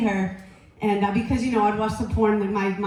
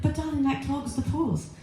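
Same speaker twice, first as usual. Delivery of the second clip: put-on slower British accent; British gynecologist; 3 semitones higher, 4 dB quieter than usual. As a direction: caution slowly as a British doctor. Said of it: "she's British".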